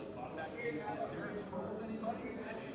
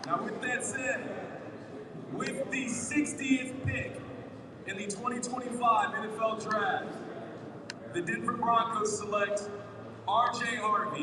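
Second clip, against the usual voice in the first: draft pick announcement; 5 semitones higher, 13 dB louder than usual. Real, not acted. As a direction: call the pick out to the crowd.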